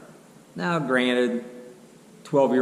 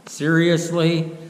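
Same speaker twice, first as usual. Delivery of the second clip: incredulous; 6 semitones higher, 5 dB louder, much slower than usual. Retold or imitated. Disbelief at driver leaving.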